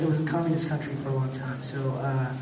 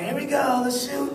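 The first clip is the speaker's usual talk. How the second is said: high, bright call to start the take; mock director shouting action; 9 semitones higher than usual. Director calling the shot.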